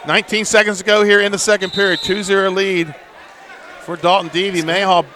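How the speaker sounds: high-pitched, steady commentary; live play-by-play. Real, not acted. Announcer sums up second period for listeners, live play-by-play. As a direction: call the clock and score to the audience.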